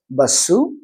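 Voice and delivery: "basu" with an added u at the end; "basu", bus stretched into two syllables; vowel ending. Shown as a demonstration. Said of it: "because it ends with a vowel sound".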